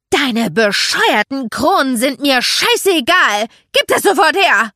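loud, high, demanding yell; angry shout. Acted. Child snapping at another over crowns, angry shouted demand. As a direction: shout it like a furious squabbling kid.

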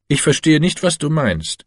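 husband cuts in sharply, caught off guard; sharp retort. A narrator's reading. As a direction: bite the answer back sharply, pitch up. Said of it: "erwiderte er scharf".

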